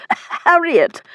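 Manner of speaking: high-pitched shocked gasp; spluttering between gasps. A narrator's reading. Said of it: "he continued between gasps".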